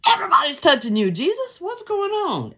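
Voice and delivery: fast, high, incredulous protest; innocent disbelief. Retold or imitated. Preacher voicing disciples protesting to Jesus, quick high disbelief. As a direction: lightly play the naive disciples. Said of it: "And the disciples, in all their innocence".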